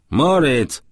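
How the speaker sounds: high, loud call to another room. A narrator's reading. Man calls his flatmate in the other room, calling out. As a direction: call the name through to the next room. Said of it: "zawołał do drugiego pokoju".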